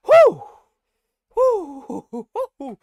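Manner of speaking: high, loud ecstatic whoops; excited whooping. Acted. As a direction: whoop with delight at the speed. Dwarf whooping after fast spell.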